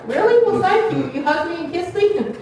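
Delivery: high, squealing, delighted; a high, thrilled voice, flattered by the devil; flattered giggly reply. Retold or imitated. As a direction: play a woman lapping up a compliment. Voicing a flattered churchgoer.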